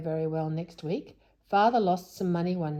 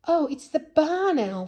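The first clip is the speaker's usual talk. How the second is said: relieved surprise; 10 semitones higher, 7 dB louder than usual. Acted. Relieved discovery.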